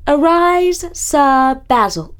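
loud, high royal proclamation, slow; grand rich voice. A narrator's reading. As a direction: knight him with ceremony. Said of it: "she declared".

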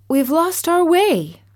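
put-on high lost little girl; high young girl. A narrator's reading. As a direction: say it high and worried. Dorothy pleads.

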